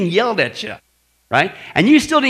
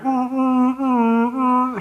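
higher, held horn honks; imitated car horns; 5 semitones higher, much slower than usual. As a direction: honk repeatedly like passing cars. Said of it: "horns going off like that".

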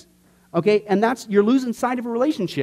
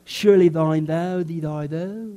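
slow, lower mock King James prayer; mock pious prayer; 5 semitones lower, much slower than usual. Pastor mocking stiff archaic prayer, slow and low. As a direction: parody an old-fashioned prayer voice. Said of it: "is that the only way that we can speak to God?".

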